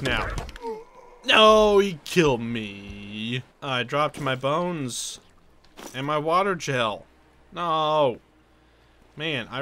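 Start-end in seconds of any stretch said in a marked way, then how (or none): 1.2-1.8 loud, high cry of defeat; anguished shout. Real, not acted. Gamer just killed by slam attack, anguished shout. 1.8-8.2 slow, drawn-out moan of defeat; dejected lament. Real, not acted. Gamer killed and losing his bones, dejected.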